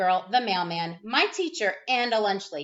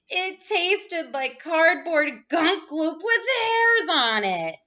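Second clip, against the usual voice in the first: disgusted; 5 semitones higher, 4 dB louder, slower than usual. A narrator's reading. Disgusted at cookie taste.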